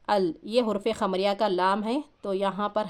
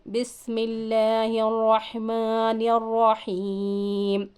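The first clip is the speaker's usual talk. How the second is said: slow, drawn-out tajweed chant; Quranic recitation; much slower than usual. Shown as a demonstration. Teacher recites slowly to the student. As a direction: recite it slowly, stretching the long vowels.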